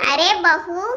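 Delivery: ordinary talk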